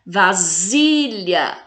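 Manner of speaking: "Vasilha" slowly, SI held long; very drawn-out SI in "Vasilha"; tonic syllable stressed. Shown as a demonstration. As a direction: stretch SI in "Vasilha". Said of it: "fala com mais intensidade".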